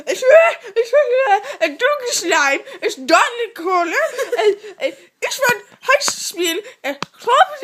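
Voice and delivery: high, loud gibberish German rant; mock German yelling. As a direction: imitate a man yelling in garbled German. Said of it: "he's yelling at me".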